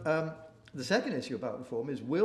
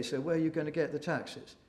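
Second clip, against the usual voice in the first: ordinary talk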